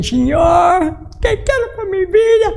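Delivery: high, pleading cry to God; near tears. Retold or imitated. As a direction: re-enact a tearful, desperate prayer. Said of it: "yo estaba casi llorando, decía".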